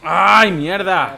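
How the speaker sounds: loud slow wail as you fall; screaming while falling. Real, not acted. Falling in game.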